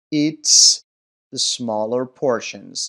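loud, separate "eats", then slow careful words; pronunciation demo. Shown as a demonstration. Teacher checking answer seven, emphatic "eats". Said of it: "طریقهٔ تلفظ درستش رو".

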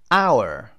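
"hour" with no h, opening on the vowel; silent h. Shown as a demonstration. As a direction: drop the h in "hour" entirely. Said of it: "اینجا اچ دیگه تلفظ نمیشه".

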